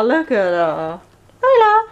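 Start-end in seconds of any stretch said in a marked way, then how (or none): 1.4-1.9 high cooing pet voice; high sympathetic coo. Real, not acted. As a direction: coo at a frightened puppy. Calling the dog.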